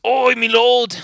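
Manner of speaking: high, groveling peasant voice; medieval peasant. Acted. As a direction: beg the lord for scraps, high-pitched. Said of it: "Medieval peasant voice begging the lord for scraps".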